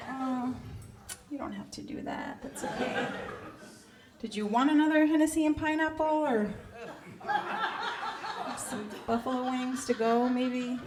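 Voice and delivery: small, soft, awkward reply; hushed, uncomfortable politeness, hammed up; meek waitress. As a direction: answer the customer timidly, voice dropped. Waitress answering catcall awkwardly.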